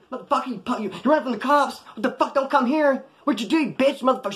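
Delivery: high-pitched mock female tirade; outraged, high voice imitating her rant; imitated angry cussing out. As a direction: act out a woman cussing someone out. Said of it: "She started cussing me out".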